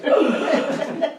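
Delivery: breathy gasp of alarm with a laugh; mock startled gasp. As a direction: gasp as if he might hit you. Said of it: "so I was like".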